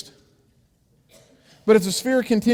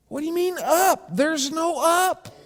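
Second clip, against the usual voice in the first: much higher, slow disbelief; baffled square; 10 semitones higher than usual. Acted. High slow incredulity. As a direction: square scoffs that up does not exist, high and slow.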